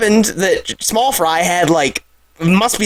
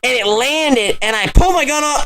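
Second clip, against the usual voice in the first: ordinary talk, nothing marked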